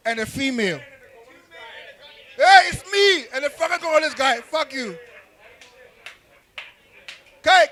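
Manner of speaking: usual speaking voice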